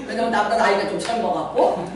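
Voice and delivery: normal talking voice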